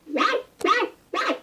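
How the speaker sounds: high playful puppy barks; mischievous bark. A narrator's reading. Mischievous puppy barks up at the squirrel, high and playful. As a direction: yap like a cheeky puppy. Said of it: "barked the mischievous puppy".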